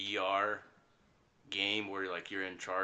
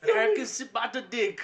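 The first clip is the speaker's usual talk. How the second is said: put-on higher and louder, laughing in a German accent; half-laughing German accent, louder; 12 semitones higher, 9 dB louder than usual. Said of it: "I miss your German accent".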